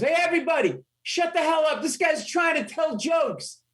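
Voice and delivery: put-on very high-pitched drunk calling out; hammed-up holler, high but not full volume; mock yell to a crowd. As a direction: imitate a drunk silencing the whole bar. Drunk calls out to the bar.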